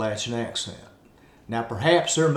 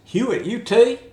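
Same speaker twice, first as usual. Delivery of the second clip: high, louder Appalachian question; Appalachian accent; 10 semitones higher, 6 dB louder than usual. Retold or imitated. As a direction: ask it high and loud in Appalachian.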